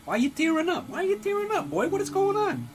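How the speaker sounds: way up high, startled concern; high-pitched concern. Acted. Father to his teary son, in a high falsetto worry.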